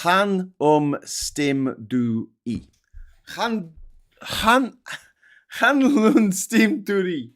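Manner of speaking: "Chan Lun Stim Du Ri" syllable by syllable, loud and slow; struggling pronunciation. Real, not acted. Streamer retrying a Welsh place name, struggling pronunciation. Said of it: "I can't do it".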